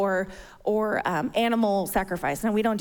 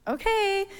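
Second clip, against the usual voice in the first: put-on sassy kid; 7 semitones higher, 3 dB quieter, much slower than usual. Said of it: "she had sass".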